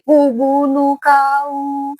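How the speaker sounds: high, very drawn-out sweet-talk threat; mock gentle. Fake gentle person making a threat, mock gentle. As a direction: stretch each syllable high in exaggerated gentleness. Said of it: "banyak juga lembut, ngomong yang ini".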